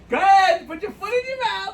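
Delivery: loud, high goading voice; egging someone on, high and loud; mock goading. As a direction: voice a taunting look at high pitch and volume. Voicing what a look says.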